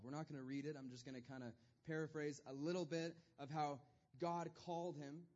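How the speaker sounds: ordinary talk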